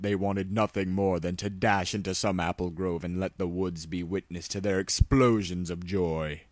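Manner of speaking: usual speaking voice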